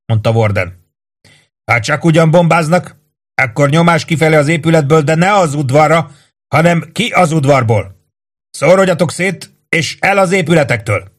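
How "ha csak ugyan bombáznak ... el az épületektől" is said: raised, hard commanding voice; stern sergeant's orders. A narrator's reading. Sergeant Warden orders the men to stay inside, stern raised command. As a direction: lay down the law to the company.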